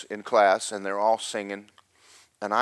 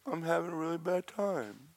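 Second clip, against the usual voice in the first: high, soft little whine; mock-pitiful, small high voice; pitiful self-imitation; 9 semitones higher, 6 dB quieter than usual. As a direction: play up the poor-me complaint. Retelling his pitiful reply.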